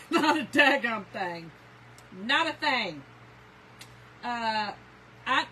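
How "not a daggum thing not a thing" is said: loud, slow, laughing emphasis. Real, not acted. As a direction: laugh through the words. Laughing about picky husband.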